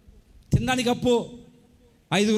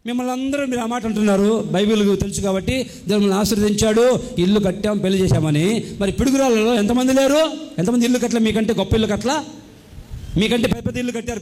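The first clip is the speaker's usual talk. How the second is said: loud rebuke to the church; forceful preaching; 4 dB louder than usual. Acted. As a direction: confront them at full volume. Rebuking loudly.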